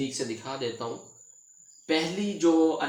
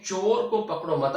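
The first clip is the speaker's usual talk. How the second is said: higher "चोर को पकड़ो मत", "मत" closing the phrase; stop after मत; 4 semitones higher, 4 dB louder than usual. Shown as a demonstration. Teacher showing the changed effect, stop after "मत". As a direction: run "पकड़ो" straight into "मत" and stop. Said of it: "अब इसमें तासुर देखें".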